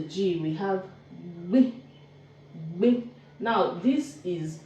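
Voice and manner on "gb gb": "B" as a short "gbeh", g and b released together; double-consonant demo. Shown as a demonstration. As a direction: sound out "B" as one quick "gbeh". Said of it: "So you pronounce that as".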